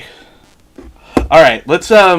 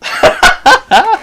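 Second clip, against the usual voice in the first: high bursting laugh; sudden staccato cackle; 13 semitones higher, 3 dB louder than usual. Real, not acted. Laughing at the deadpan reply.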